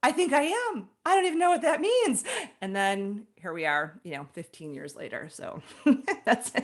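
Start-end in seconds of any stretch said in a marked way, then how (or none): 0.0-2.2 high, bemused, unsure agreement; bemused acceptance. Retold or imitated. Telling the host how she accepted, high bemused voice. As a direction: accept it while still puzzled.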